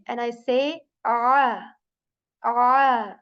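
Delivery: ah pressed from a tightened throat; choked ah. Shown as a demonstration. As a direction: tighten the throat muscles and squeeze out ah. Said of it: "then I kind of choke the sound".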